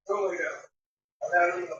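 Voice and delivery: slow, soft command to the dog; gently but firmly send her to bed; ordering the dog to lie down, slow and soft. Real, not acted. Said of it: "No, no, no, no".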